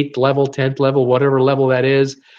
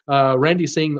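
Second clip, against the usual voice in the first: ordinary talk, nothing marked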